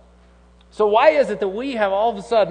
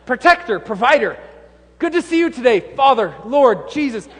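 son's mock-formal greeting; 6 semitones higher, 6 dB louder than usual. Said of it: "he says".

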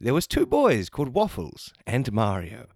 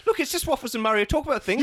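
panicked pleading; 11 semitones higher, faster than usual. Acted. Panicked suspect.

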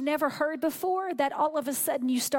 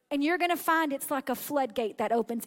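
ordinary delivery

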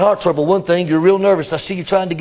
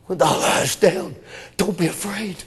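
normal talking voice

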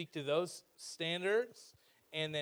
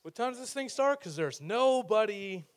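high, loud, confused newcomer; puzzled visitor; 6 semitones higher, 7 dB louder than usual. Retold or imitated. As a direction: ask high and baffled, drawing out the end. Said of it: "So they're like".

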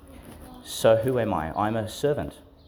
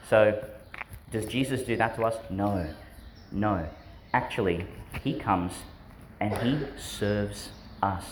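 usual speaking voice

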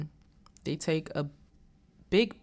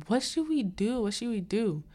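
high-pitched imitation of panicking; mock panic; 7 semitones higher than usual. The girls are at a loss, mock-panicked. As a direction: fret aloud, pitched well up. Retold group panicking over a death.

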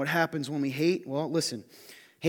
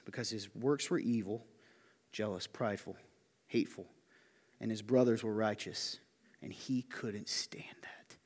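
softer and lower, sinking to a whisper; hushed reading; 6 semitones lower, 8 dB quieter than usual. Acted. Preacher reads Cain's verse to the congregation, hushed to a whisper. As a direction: let the verse fade into a whisper.

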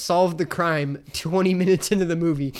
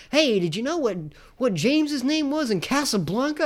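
high, eager mock quiz question; imitating an annoying know-it-all; pestering trivia coworker; 6 semitones higher than usual. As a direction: pitch it up, butting in with trivia.

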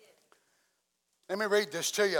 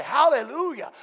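higher, louder joyful church shout; shouted hallelujah; 6 semitones higher, 6 dB louder than usual. Retold or imitated. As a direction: call it out like a worshipper jumping up. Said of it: "and they say".

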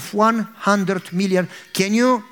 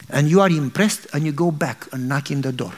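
normal talking voice

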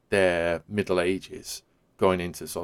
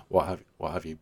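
ordinary delivery

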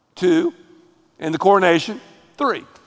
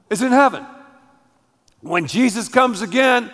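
usual speaking voice

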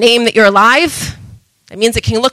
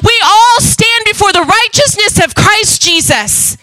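higher, ringing pulpit proclamation; fiery preaching; 6 semitones higher than usual. Acted. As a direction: preach it out with full conviction. Preaching at full force.

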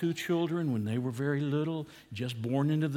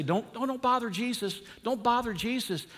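mock shooing voice; 7 semitones higher than usual. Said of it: "Don't let them say".

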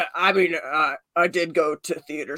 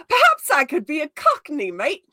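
hammed-up Cockney accent, pitched up at the start; Cockney affectation; 7 semitones higher, 4 dB louder than usual. Shown as a demonstration. Offers the party a voice to put on, in hammed-up Cockney. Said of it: "an affectation with your voice".

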